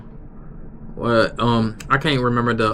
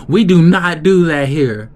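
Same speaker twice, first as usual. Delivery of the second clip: loud, high mock scolding; imitating an angry boss; 6 semitones higher, 7 dB louder than usual. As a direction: scold them like the heated coworker. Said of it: "he was mad".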